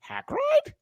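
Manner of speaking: a squeaky, startled "Hagrid?", pitched far up; surprised AI Seinfeld. Retold or imitated. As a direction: mimic the AI voice caught off guard, yelping the name high. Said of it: "it sounded like he was surprised".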